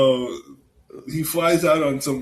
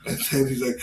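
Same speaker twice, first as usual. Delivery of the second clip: ordinary delivery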